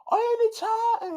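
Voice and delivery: high, loud mock-sassy voice; mock reply. Announcer mocked answering Becky, high and loud. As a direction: fire back in a high, loud put-on voice. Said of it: "But she's like".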